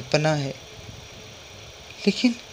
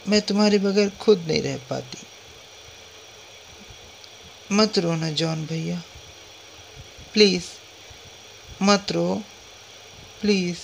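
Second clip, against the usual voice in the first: put-on pleading little girl; 2 semitones higher, 5 dB quieter than usual. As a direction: coax a crying brother like a small child. Child begs crying brother to stop.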